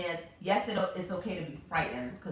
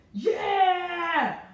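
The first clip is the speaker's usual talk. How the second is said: much higher, louder triumphant cry; victory shout; 12 semitones higher, 7 dB louder than usual. Retold or imitated. Mother after crushing the bug, shouting in triumph. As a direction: celebrate the kill.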